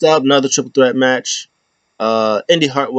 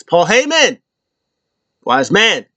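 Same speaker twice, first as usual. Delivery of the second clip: raised-pitch imitation of calling out; mock proclamation; 7 semitones higher, slower than usual. Wrestler addressing his wise man before the crowd, mock proclamation. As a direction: call him out like a wrestler on the mic. Acts Solo calling out Paul.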